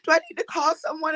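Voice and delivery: high-pitched worried questioning; concerned husband. Retold or imitated. Caller acting her husband grabbing her, high concern. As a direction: ask urgently in a high, anxious voice. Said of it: "But he was so concerned".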